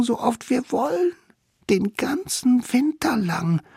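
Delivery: high excited little savage; bubbling over with the plan; eager child voice. A narrator's reading.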